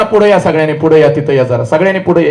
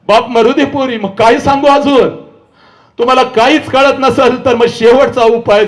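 ordinary delivery

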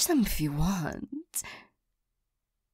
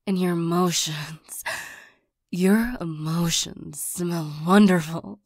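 ordinary talk

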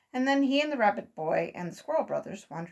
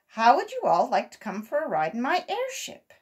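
brighter, louder invitation; cheerful offer; 5 semitones higher, 7 dB louder than usual. A narrator's reading. Uncle Wiggily inviting the boys aboard his airship, eager. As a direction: tempt the children with a treat.

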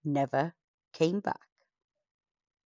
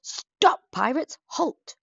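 loud, slow, higher yell of stop; 9 semitones higher, 5 dB louder, much slower than usual. Acted. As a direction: shout at the pirates to halt. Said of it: "she ran yelling".

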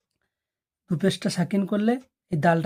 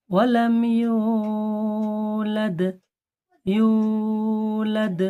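slow melodic tajweed chant; Quran recitation; 3 semitones higher, 3 dB louder, much slower than usual. Shown as a demonstration. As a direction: recite each verse slowly, repeating. Recitation drill. (ধীর সুরে তিলাওয়াত)

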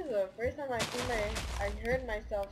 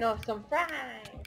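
drawn-out; much slower than usual. Acted.

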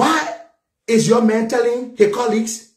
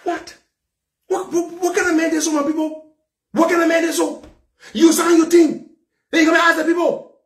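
angry shouting; 7 semitones higher than usual. Real, not acted. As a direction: shout it out in anger. Angry political rant opening.

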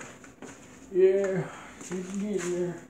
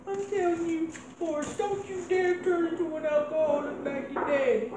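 Ma warning; 9 semitones higher than usual. Acted. Mother warning Forrest about drinking, in a high pleading voice. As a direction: plead with him not to turn out like his dad.

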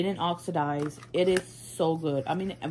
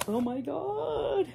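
excited squeal; 12 semitones higher, slower than usual. Real, not acted. Reacting to next item.

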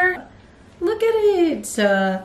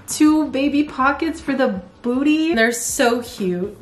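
ordinary talk, nothing marked

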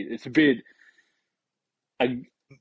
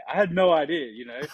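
normal talking voice

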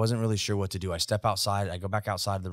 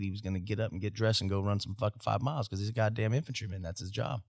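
ordinary talk

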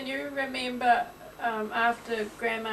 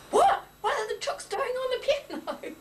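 startled disbelief; 13 semitones higher than usual. Retold or imitated. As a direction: jolt up in bed, squawking in disbelief. Mum woken, chooks on piano.

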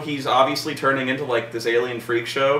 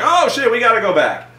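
high, louder retold panic; mock alarm; 10 semitones higher, 5 dB louder than usual. Crew realising they must turn back, mock alarm.

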